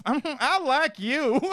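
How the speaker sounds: high, slow, smitten approval; lightly mocked flirty staffer; charmed staff. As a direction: coo it like a won-over waitress. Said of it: "they'd be like".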